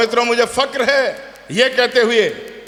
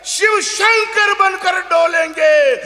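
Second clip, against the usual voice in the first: much higher, slow, shouted over the crowd; climactic shout; 10 semitones higher, slower than usual. Acted. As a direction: roar the finale.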